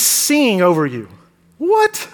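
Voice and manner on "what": high, incredulous What; stunned what from the pulpit; amazed. Real, not acted. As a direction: jump up in disbelief. Amazed at God singing.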